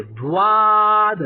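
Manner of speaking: دواد stretched very slowly and loud, with a w glide after the d; wrong pronunciation demo. Shown as a demonstration. The teacher loudly imitates the mistake of reciters who add a waw. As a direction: drag out دواد like duwaad with a w sound. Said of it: "وہ کیا کرتے ہیں، واو نکالتے ہیں".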